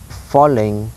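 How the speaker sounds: slow "falling" with both syllables weighted; "falling" drawn out, stressed on both halves; two stressed syllables. Shown as a demonstration. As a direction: stretch "falling" into two equal beats. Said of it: "two stress syllables".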